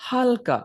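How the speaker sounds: হালকা jumped up high; হালকা said high and pointed; stressed halka. Shown as a demonstration. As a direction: lift হালকা sharply up. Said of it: "হালকা করে".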